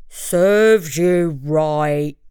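low, slow growl; slow bear growl, very low. Acted. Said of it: "he growled".

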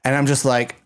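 usual speaking voice

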